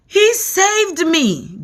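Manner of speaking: high, slow, breathless gasp; rescued centipede gasping. A narrator's reading. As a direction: gasp it out, worn out. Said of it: "gasped the centipede".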